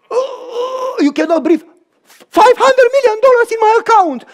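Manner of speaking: high, loud, cracking cry of disbelief; overwhelmed cry. Acted. Man staring at his balance, high breathless shout. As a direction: shout it like you can't breathe. Shocked at half a billion.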